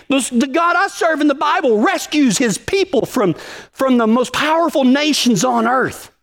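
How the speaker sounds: high, pressing preacher voice riding up the pitch; fired-up preaching. Acted. Pastor to his cowboy congregation, fired-up preaching. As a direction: preach it with rising heat.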